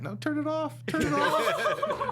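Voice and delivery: put-on high, slow pleading kid voice; hammed-up little boy whine; whiny child. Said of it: "he went".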